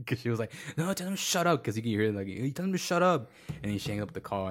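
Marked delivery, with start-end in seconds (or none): 0.8-1.6 high-pitched mock angry order; mad country girl. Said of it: "'cause she got mad". 2.3-3.3 high, indignant mock command; mad country girl. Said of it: "'cause she got mad".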